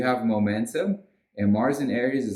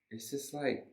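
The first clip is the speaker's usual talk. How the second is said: ordinary delivery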